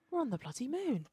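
lightly voiced stunned Martha; imitating Martha. Fan imitates Martha standing there, mildly stunned. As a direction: say it flatly amazed. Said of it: "she's like".

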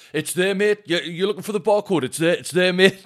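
imitating the irritated shopper loudly; mock annoyed bloke. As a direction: snap at him, pointing out the barcode. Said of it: "And he just went".

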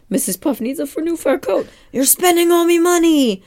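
high, put-on voice of a worked-up crab boss; Mister Krabs. Crab boss complains to SpongeBob about his spending, high put-on voice. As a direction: wail it high and loud over the money. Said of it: "Mister Krabs is like".

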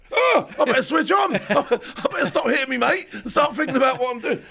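hammed-up alarm, half laughing; mock panic. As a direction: snap yourself awake in a high voice. Acts out own sudden panic before landing.

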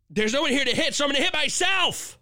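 high, worked-up exasperation; exasperated outburst. Real, not acted. Host too angry to sit still, high exasperation. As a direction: throw up your hands. Exasperated joke after outrage.

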